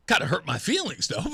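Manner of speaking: joking self-pity trailing into a laugh; mock hurt with a laugh. Real, not acted. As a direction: pretend to be wounded, amused.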